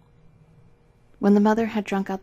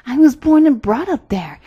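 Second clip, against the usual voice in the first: loud, raised, eager reindeer voice; proud, sparkling reindeer; 5 semitones higher, 9 dB louder than usual. A narrator's reading. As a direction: declare it with eager pride. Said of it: "with his eyes sparkled".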